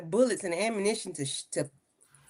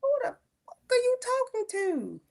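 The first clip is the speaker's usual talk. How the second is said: high-pitched, drawn-out incredulous demand; slow, much higher, affronted questioning; indignant challenge; 13 semitones higher than usual. Retold or imitated. Woman acting out what he should have said back, high indignant challenge.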